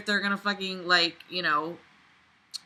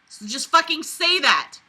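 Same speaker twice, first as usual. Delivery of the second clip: higher, louder frustrated outburst; fed-up shout at clumsy writing; exasperated yell; 9 semitones higher, 5 dB louder than usual. Real, not acted. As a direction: snap it at the author. Ranting at the author.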